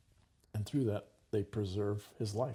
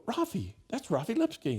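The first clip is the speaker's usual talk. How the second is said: much higher startled cry of recognition; recognizing him; 12 semitones higher, 5 dB louder than usual. Retold or imitated. Nieces spotting the hidden boy on the road, startled high cry. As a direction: blurt out the name in shock.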